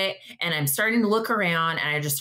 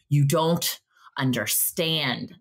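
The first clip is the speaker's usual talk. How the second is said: slow, drawn-out plea; desperate plea; 2 semitones lower, much slower than usual. Retold or imitated. Begging the clerk to hold the game, very slow desperate plea. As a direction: stretch every word, begging. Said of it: "I go".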